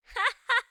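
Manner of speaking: short high giggle. Acted. Goldilocks relieved at mix-up, high giggle. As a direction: burst out giggling.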